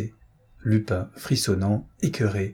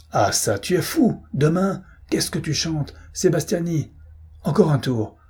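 furious outburst; 8 semitones higher than usual. Acted. Marquis furious at delay.

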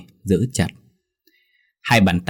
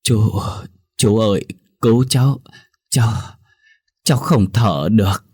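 put-on weak choking child; 2 semitones higher than usual. A narrator's reading. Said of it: "Giọng nói cất lên yếu ớt".

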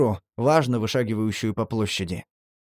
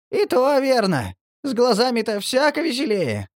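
high creaky ghoul voice; 11 semitones higher than usual. A narrator's reading. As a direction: squeak like a blind ghoul. Marley's high creature voice.

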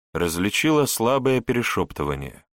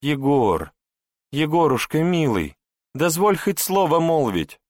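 higher, begging tone, leaning on милый and дозволь; pleading; 8 semitones higher, 3 dB louder than usual. Acted. Wife begs her husband through the door, pleading. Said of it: "попросила она".

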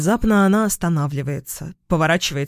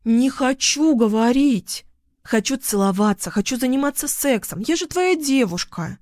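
put-on high, insistent drunken protest; whine-shout it in a higher voice; shouting girl; 7 semitones higher than usual. A narrator's reading. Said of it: "вдруг кричит она". (высоким голосом, капризно выкрикивая)

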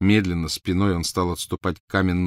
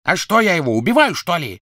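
high, loud bark; barked retort; 11 semitones higher, 6 dB louder than usual. Acted. Crabbe barks back at Malfoy, high and loud. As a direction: snap back with contempt. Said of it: "рявкнул Крэбб".

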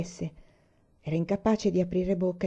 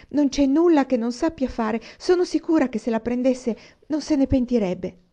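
begging; 6 semitones higher, 5 dB louder than usual. Acted. Child begging to adopt friend.

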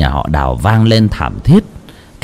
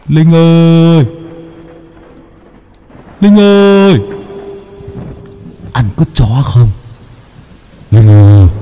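eerie calling; 5 semitones higher than usual. Acted. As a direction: call her name from the dark. Ninh Thọt calls Linh.

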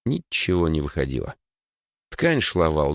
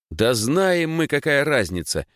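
high-pitched indignant retort; 8 semitones higher, slower than usual. A narrator's reading. Эдвард defends the order's name to his friend, indignant. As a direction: push back, pitch jumping up. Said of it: "возмутился Эдвард".

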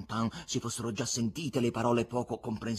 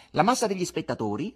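higher, louder mocking echo; insolent interruption; 8 semitones higher, 8 dB louder, faster than usual. Buffoon cuts off the patron with his own words, insolent. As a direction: throw the words back with a rising, cheeky tune. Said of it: "lo interruppe l'insolente buffone".

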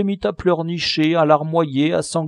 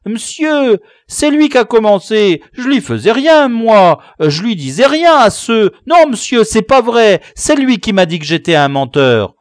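put-on high, loud child voices pleading with the teacher; squabbling schoolboys; 8 semitones higher, 8 dB louder than usual. Schoolboys answering the teacher, loud high protest. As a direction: boys protesting their innocence, all talking at once. Boys accuse each other.

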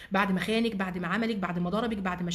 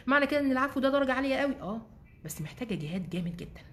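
usual speaking voice

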